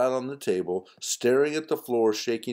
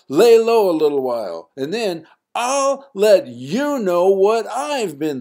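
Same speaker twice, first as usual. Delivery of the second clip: high, loud defiant shout; 11 semitones higher, 8 dB louder than usual. Acted. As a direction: yell it at your parents. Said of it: "I'm shouting".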